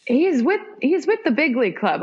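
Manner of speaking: high, insistent retold brag for someone too humble; exasperated boast. Speaking up for the humble player, exasperated. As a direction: pitch it up, speaking up for him.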